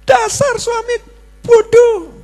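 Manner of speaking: high mock wife's shriek. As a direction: screech it like an angry wife. Wife insulting husband.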